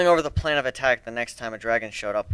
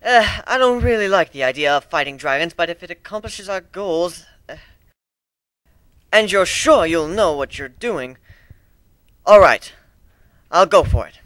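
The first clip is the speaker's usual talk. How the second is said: stammering; 6 semitones higher, 7 dB louder than usual. Acted. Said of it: "Trixie stammered".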